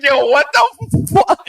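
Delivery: retold disbelief. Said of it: "I was just like".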